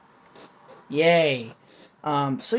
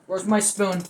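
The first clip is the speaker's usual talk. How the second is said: ordinary talk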